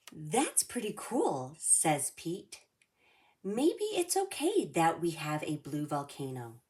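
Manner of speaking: lively read-aloud storybook voice; reading to children. A narrator's reading. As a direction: read it like storytime for little kids. Reads picture book to kids.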